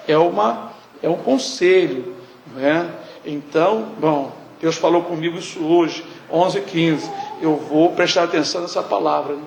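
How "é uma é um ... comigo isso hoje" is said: normal talking voice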